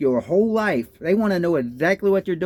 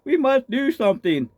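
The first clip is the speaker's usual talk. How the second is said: mock patriot quote; 6 semitones higher than usual. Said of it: "hearing the Patriots saying".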